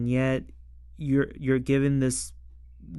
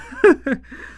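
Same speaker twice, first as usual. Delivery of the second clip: laugh; 10 semitones higher, 8 dB louder than usual. Real, not acted. Amused by actors in rain.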